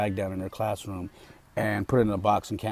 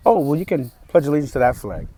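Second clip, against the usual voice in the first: higher, louder imitation of a joking teacher; mock amused teacher; 5 semitones higher, 8 dB louder than usual. Teacher pointing kids to another flag, mock amused. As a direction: mimic her breezy suggestion, pitched up and loud. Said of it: "And she laughs on camera".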